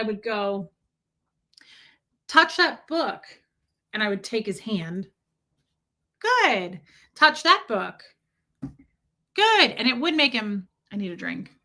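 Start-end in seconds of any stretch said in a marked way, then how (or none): 2.3-3.2 high, lilting instruction to an infant; retold baby command. Re-enacting commands to crying baby. 6.2-9.7 high, louder praise and instruction; retold baby coaxing. Re-enacting commands to crying baby.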